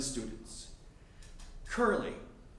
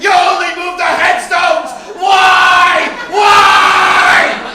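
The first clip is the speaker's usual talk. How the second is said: full-throated, high-pitched movie tirade, far louder; enraged screaming; 9 semitones higher, 14 dB louder than usual. Acted. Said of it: "grabs him by the lapels and screams".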